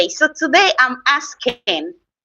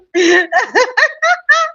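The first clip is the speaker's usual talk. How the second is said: high, loud burst of real laughter; 12 semitones higher, 3 dB louder than usual. Real, not acted. Streamer reading chat bursts into high laughter.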